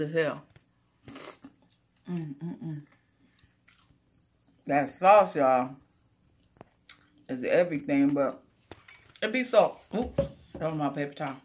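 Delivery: chewing while talking; talking between bites; mouth full. Real, not acted. Seen 0: Bites pot sticker off fork, then chews through labelled words.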